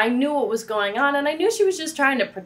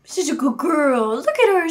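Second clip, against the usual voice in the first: put-on doggy baby talk; 4 semitones higher than usual. Cooing at the dog.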